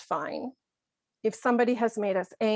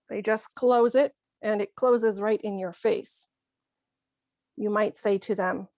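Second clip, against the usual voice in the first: ordinary talk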